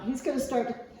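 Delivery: normal talking voice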